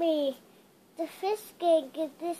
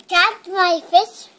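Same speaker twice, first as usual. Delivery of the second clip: loud; 9 dB louder than usual. Real, not acted. Child shouting in play.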